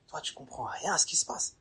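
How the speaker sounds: hushed confiding aside; whispered aside. Acted. Aside to the listener.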